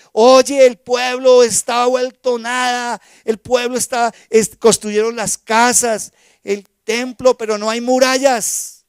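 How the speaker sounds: loud, slow, alarmed messenger bringing bad news; urgent report. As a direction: call it out like ruinous news. Hanani reports the ruined city. (Hanani le avisa a Nehemías, alarmado y fuerte)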